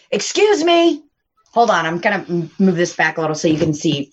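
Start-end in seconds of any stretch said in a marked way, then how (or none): 0.1-1.0 high, querulous call for attention; calling customer. Retold or imitated. Server imitating Jerry calling from his car, high impatient call. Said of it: "he was in his car like".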